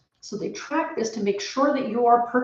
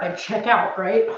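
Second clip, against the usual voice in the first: ordinary talk, nothing marked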